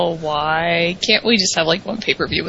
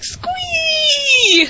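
fangirl squee. Retold or imitated. Internet fans reacting to the photo, squealing with delight. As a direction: squeal like a thrilled fan. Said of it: "The internet was like".

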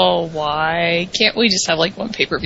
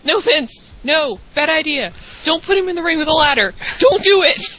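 mock pleading; 8 semitones higher than usual. Fan begging Vince not to book a ladder match, mock pleading.

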